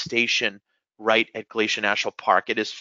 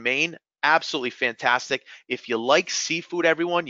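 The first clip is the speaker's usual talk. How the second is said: ordinary talk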